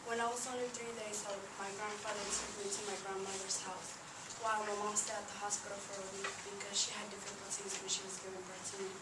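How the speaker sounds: plain reading voice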